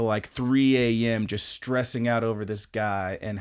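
normal talking voice

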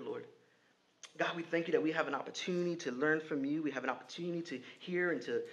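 soft, low, reverent prayer. Acted. Pastor leading the church in prayer, soft and reverent. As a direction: pray quietly over the congregation.

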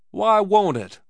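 loud, high challenge; demanding. A narrator's reading. Swede challenges the barkeeper's protest, demanding. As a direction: demand it hotly, pitched right up. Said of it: "demanded the Swede".

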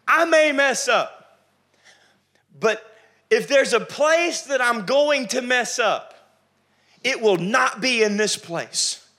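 slow, high, loud stretched-out declaration; emphatic vow. Acted. Preacher voicing a believer's firm oath before God, drawn out. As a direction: vow each phrase like it's set in stone. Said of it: "he's emphatic".

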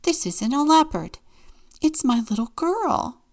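high, loud indignant protest; insulted. A narrator's reading. Mama answers the complaining parents, insulted and indignant. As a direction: defend your child, offended. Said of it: "Mama was insulted".

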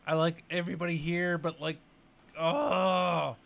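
high exasperated groan at a bore; annoyed groaning. Retold or imitated. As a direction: sigh it out, fed up. Imitating annoyed viewer.

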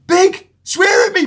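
put-on high, slow excited child; pleading kid voice. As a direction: beg like an eager young fan. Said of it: "and they were going".

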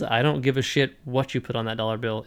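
ordinary talk, nothing marked

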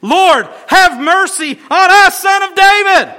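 high, loud pleading shout; desperate cry. Acted. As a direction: scream for mercy over the crowd. Beggars crying louder.

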